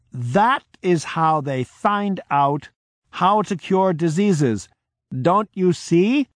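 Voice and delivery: high, slow, insistent plea; forceful persuasion. Retold or imitated. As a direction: drive the point home slowly.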